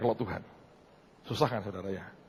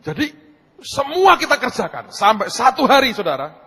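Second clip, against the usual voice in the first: high, loud preacher's build; emphatic preaching; 11 semitones higher, 9 dB louder than usual. Real, not acted. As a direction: proclaim it loudly to the room.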